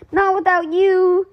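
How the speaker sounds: high, loud, slow refusal; determined cry. Acted. Nicky refusing to abandon Alexander, loud. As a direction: refuse to leave him behind. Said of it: "said Nicky".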